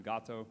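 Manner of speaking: Gato said GAH-toe; Spanish-style pronunciation. Shown as a demonstration. Lecturer settling on the Spanish term, Gato as GAH-toe. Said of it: "I use the Spanish term".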